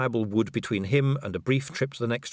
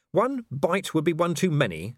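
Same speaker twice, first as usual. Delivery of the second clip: higher, lilting mantra; vampire lady voice; 7 semitones higher than usual. Acted. Vampire lady quoting Vimes's mantra, higher and lilting. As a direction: repeat the mantra back lightly. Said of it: "He recognized the mangled mantra".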